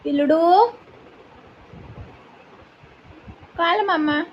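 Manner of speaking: high, sing-song pet talk. Real, not acted. Owner soothing her anxious puppy, high cooing baby talk. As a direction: coo to coax the puppy.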